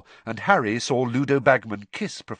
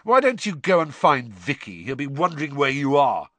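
louder, higher, spiteful; needling, scornful dismissal; jealous taunt; 4 semitones higher, 4 dB louder than usual. Acted. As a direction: goad with mock helpfulness. Ron taunts Hermione about Vicky.

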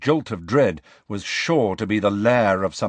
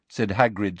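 ordinary delivery, nothing marked

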